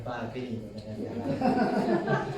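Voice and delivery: slow, laughing sugary mock praise; mock hypocritical gushing. Mocking a hypocritical brother, slow laughing fake sweetness. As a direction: parody a fake-sweet churchgoer, slowly. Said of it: "hipocresía y pico".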